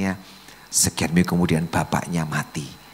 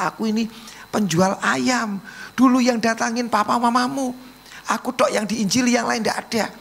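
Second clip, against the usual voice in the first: high, insistent preacher's retelling; impassioned testimony. Acted. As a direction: tell it in a high, fervent voice.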